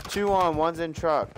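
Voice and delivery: ordinary talk, nothing marked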